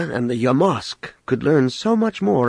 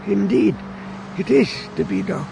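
put-on old man voice; 8 semitones higher than usual.

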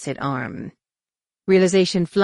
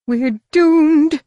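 high, loud despairing droid wail; keening wail; 11 semitones higher, 8 dB louder than usual. Acted. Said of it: "he keened".

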